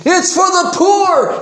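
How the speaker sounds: hammed-up righteous cry; mock shout. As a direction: bellow the fake virtue. Mocking hypocrites' charity claim.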